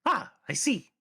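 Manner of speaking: very high-pitched friendly goblin; high, hailing goblin voice; goblin character, friendly. Acted. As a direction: greet them as an eager little goblin.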